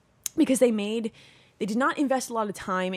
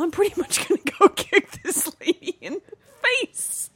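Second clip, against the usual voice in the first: laughing; 9 semitones higher, 5 dB louder than usual. Pretend. Acting out game character, laughing.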